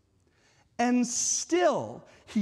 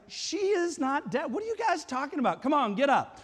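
high, breezy mock dismissal; exaggerated offhand impatience; nonchalant; 7 semitones higher than usual. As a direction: brush off their worry, pitched up and quickening. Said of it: "nonchalant in the way that he's like".

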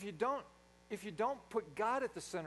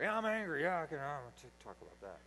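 easy anger; 3 semitones lower than usual. Acted. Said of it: "And angry comes out easier".

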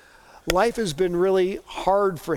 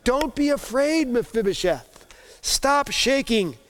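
higher, tender comfort to a shaking man; reassuring king; 7 semitones higher than usual. Acted. King comforts trembling cripple.

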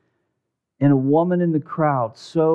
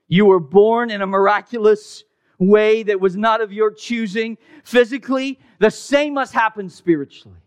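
louder and higher, driving the point home; preaching at full force; 8 semitones higher, 4 dB louder than usual. Acted. Preacher pressing Nicodemus's lesson on the congregation, loud and high. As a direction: proclaim it like a rising sermon climax.

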